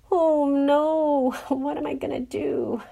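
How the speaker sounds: high, hammed-up cry of dismay; Clacy, crying out. A narrator's reading. Character at a loss what to do, crying out. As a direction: wail it loudly, then drop the volume. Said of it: "Cried Clacy".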